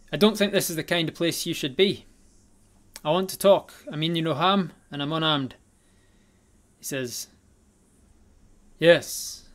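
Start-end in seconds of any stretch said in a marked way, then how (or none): 0.1-5.5 louder, higher stranger voice, confident; hooded figure warning the party, loud and confident. Acted. 8.8-9.5 a loud stressed yes; hooded stranger answering loudly. Acted.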